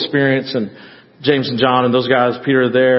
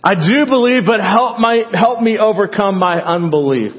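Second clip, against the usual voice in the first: put-on father pleading for his sick child, crying out; 6 semitones higher than usual. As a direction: cry out as a desperate father. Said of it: "The father cries out".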